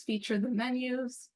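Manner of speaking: usual speaking voice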